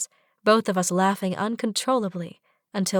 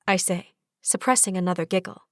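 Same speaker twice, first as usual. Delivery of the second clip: plain reading voice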